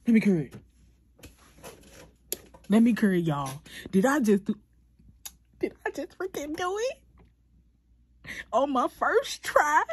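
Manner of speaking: loud, high, thrilled disbelief; excited. Real, not acted. Showing viewers her first-try flower, ecstatic and loud. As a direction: shout it out, can't believe it worked.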